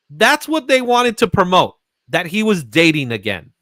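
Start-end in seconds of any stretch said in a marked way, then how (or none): none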